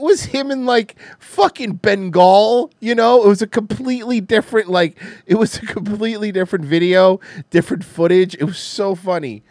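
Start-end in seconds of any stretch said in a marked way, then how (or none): none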